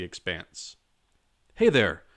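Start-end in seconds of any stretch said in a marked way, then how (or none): 1.5-2.0 shouted out across the graveyard; shouting. A narrator's reading. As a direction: call out loudly from afar. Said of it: "a man's voice rang out".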